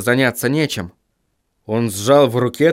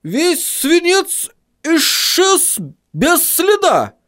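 very high, drawn-out astonished cry; high-pitched, flabbergasted dwarf; dwarf exclamation; much slower than usual. A narrator's reading. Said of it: "воскликнул гном".

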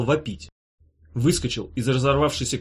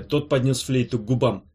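ordinary delivery, nothing marked

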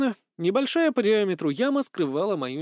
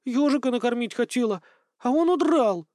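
high, aggrieved whine; vexed; 8 semitones higher than usual. A narrator's reading. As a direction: complain with rising annoyance. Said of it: "Девушка с досадой бросила".

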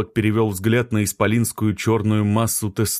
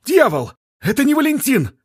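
startled high exclamation, much higher and louder; surprised; 5 dB louder than usual. A narrator's reading. Stranger realizes they are not Valentin, startled shout. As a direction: blurt it out in shock. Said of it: "удивился человек с папиросой".

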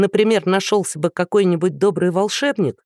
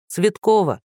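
higher-pitched call, falling at the end; 4 semitones higher than usual. A narrator's reading. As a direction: call the next name into the queue. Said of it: "выкрикнула её фамилию".

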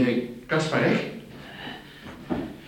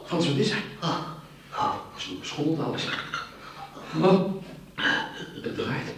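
dazed poisoning; 2 semitones higher, 5 dB quieter than usual. Acted. Poisoned, dizzy, fading.

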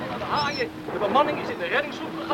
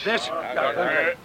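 ordinary talk, nothing marked

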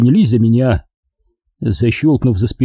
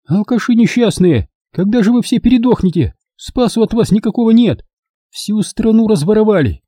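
scolding woman; 10 semitones higher than usual. A narrator's reading. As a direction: berate the drunks shrilly.

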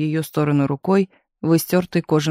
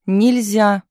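firm flat refusal, slightly raised; a stern nurse says no and won't budge; unyielding; 4 semitones higher, 4 dB louder than usual. A narrator's reading. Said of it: "непреклонно ответила".

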